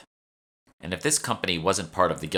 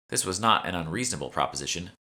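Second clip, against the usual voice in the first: ordinary delivery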